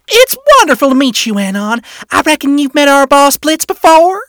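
put-on high, loud, friendly Wrath accent. A narrator's reading. Imp wife meeting Anon, loud Wrath accent. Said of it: "clearly a Wrath accent".

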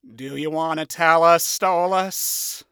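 dry sarcastic question to a nerd; sarcastically. A narrator's reading. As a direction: ask it knowing the answer. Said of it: "he asked sarcastically".

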